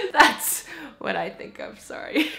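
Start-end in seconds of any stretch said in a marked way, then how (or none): none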